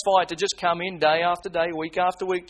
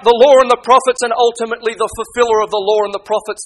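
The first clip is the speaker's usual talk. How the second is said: louder, higher preaching at full force; fiery preaching; 6 semitones higher, 8 dB louder than usual. Acted. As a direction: thunder it from the pulpit, pressing them hard. Preacher voicing the rich man's plea.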